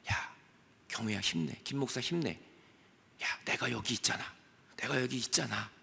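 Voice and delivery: soft, low comforting voice; tender Jesus. Acted. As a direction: gently lift up a fallen friend. (다정하게 위로하는 예수님)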